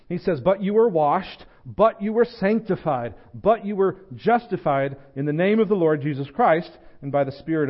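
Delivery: impassioned pulpit climax; preaching at full force. Acted. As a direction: preach it out to the congregation with mounting fire.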